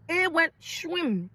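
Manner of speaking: mock whoosh, high and drawn out; whooshing sound effect. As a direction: stretch the whoosh like something flying past. Sound of insult flying over head.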